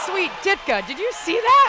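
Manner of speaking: high and loud, real astonishment; amazed cry. Real, not acted. Commentator to her co-host after a wild spin, astonished cry. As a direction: yelp it out in disbelief. Stunned by a 360 on one skate.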